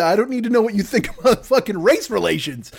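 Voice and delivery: ordinary talk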